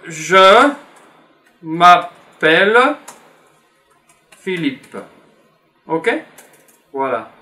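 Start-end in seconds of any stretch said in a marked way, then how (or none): 0.1-5.0 each word spaced out slowly for the class; slow dictation. Shown as a demonstration. Teacher writing the answer for students, slow and spaced. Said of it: "je vais faire ici".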